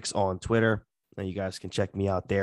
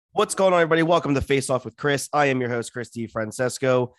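excited; 4 semitones higher, 4 dB louder than usual. Acted. Energetic second intro take.

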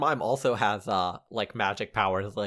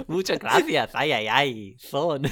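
a lighter hammed-up Spanish accent, higher; mock Spanish accent; 4 semitones higher, 3 dB louder, slower than usual. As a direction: repeat the fake Spanish-accented dad line. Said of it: "you have to do the accent too".

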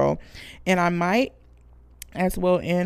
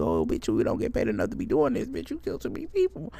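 cleanup crew complaining; 8 semitones lower, 3 dB quieter, faster than usual. Acted. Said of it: "They telling you".

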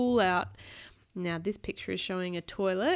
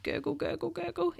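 high bubbly gurgle; gurgling tummy sound; 7 semitones higher, 5 dB quieter than usual. Acted. Tummy noise read aloud.

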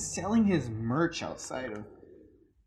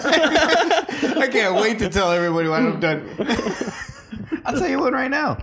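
laughing; 8 semitones higher, 5 dB louder than usual. Real, not acted. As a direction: laugh through the words. Laughing at sex story tease.

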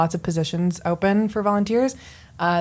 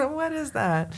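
laughing puzzled; 7 semitones higher, 4 dB quieter than usual. Retold or imitated. Imitating puzzled reader.

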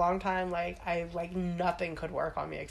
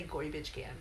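put-on Staten Island accent; 3 semitones lower, 10 dB quieter than usual. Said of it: "Staten Island accent".

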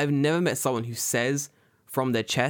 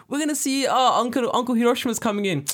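high, excited family voice, much higher; excited relative; 11 semitones higher, 3 dB louder than usual. Retold or imitated. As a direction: squeal it like a kid greeting the uncle. Family greeting the weird uncle.

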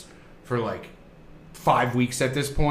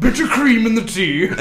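higher, laughing mock British accent; 6 semitones higher, 3 dB louder than usual. Mocking the British at tea, in a posh British accent. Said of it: "so funny in that accent".

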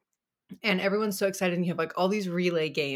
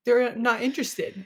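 slow, pouting self-pity; mock-dejected; 6 semitones higher, 5 dB louder, slower than usual. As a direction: play hurt about followers. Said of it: "I'm like".

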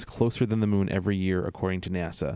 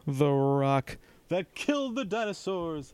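The Rock impression; 8 semitones higher, slower than usual. Shown as a demonstration. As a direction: drawl it like a wrestling promo. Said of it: "That's my rock impression".

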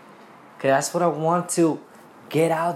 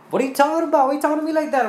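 much higher and louder, an outraged squeal; indignant mock reply; 13 semitones higher, 6 dB louder, much faster than usual. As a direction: play the offended relative, shrill and loud. Family pushing back, mock reply.

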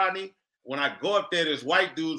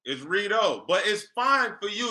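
ordinary talk

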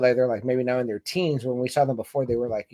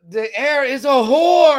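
higher, louder, slowed, wailing; hammed-up outraged cry; 9 semitones higher, 8 dB louder, slower than usual. Retelling the brother's insult at court, hammed-up wail. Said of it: "He's like".

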